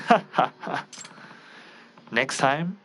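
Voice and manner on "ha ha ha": mocking laugh. Villain laughs over phone.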